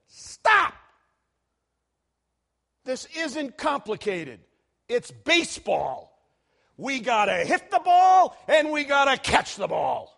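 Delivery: loud, high angry outburst at a reporter; irritated manager shouting. Retold or imitated. Beaten baseball manager snapping at a reporter's question, loud angry yell. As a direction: blow up at the reporter. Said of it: "the baseball manager said".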